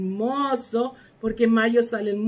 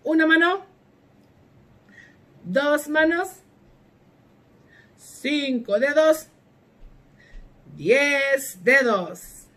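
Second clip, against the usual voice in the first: 1 teacher call-out; 4 semitones higher than usual. Shown as a demonstration. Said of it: "Yo digo primero".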